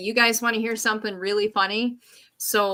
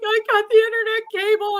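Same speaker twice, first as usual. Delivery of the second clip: upset confession; 13 semitones higher, 5 dB louder, faster than usual. Retold or imitated. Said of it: "I'm like".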